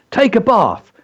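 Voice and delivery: "Take a bath" high, then falling; performance-poem punch; emphatic dragon line. Shown as a demonstration. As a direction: exclaim it as a dragon's command. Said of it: "So the emphasis is on".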